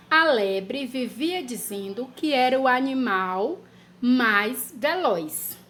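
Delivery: slow, word by word dictation; dictation pace. Shown as a demonstration. Teacher dictating a sentence to children. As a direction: dictate it slowly so children can write. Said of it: "Frase número um".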